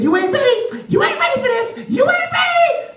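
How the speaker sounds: put-on very high boastful child; high shouting swaggering boy; cocky kid shouting. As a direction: shout the brag at her. Boy brags to Lulu.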